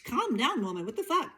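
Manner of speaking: high, exasperated self-scolding; scolding herself. Retold or imitated. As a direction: snap at your own frantic self from above. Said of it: "look down, like".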